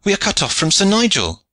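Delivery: high, urgent cry of alarm; dismayed high call; Ford's cry. A narrator's reading. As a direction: raise the alarm, pitch far up. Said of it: "cried Ford".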